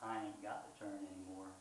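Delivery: soft, low near-whisper. A narrator's reading. Brother whispers his refusal to the narrator, low near-whisper. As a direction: say it quietly and low. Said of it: "he just whispers".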